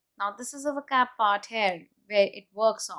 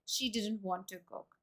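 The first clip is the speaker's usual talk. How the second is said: softer, falling tune on "She didn't want to cook"; falling intonation; 10 dB quieter than usual. Shown as a demonstration. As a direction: drop away quietly toward "cook", as if closing the conversation. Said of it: "That's called falling intonation".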